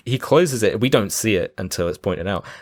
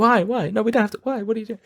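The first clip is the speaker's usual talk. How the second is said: whiny protest fading; 12 semitones higher than usual. Retold or imitated. Danny DeVito whining as the scene fades out. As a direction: whine as they walk off screen.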